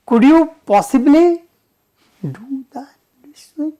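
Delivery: polite request fading to a whisper; a polite ask that drops to a whisper. Shown as a demonstration. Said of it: "That is how".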